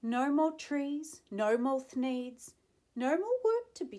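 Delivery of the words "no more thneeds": ordinary delivery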